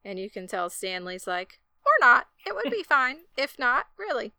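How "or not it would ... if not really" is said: high, loud mock eagerness; squeaking out a hopeful no-thanks; mock Stanley. Stanley hoping his wife skips the party, high and loud.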